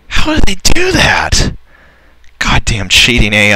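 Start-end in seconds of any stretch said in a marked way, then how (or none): 0.1-1.5 much higher, louder, slow squeaky disbelief; incredulous. Real, not acted. Gamer accusing the AI of cheating, squeaky disbelief. Enemy cannons reappear mid-battle.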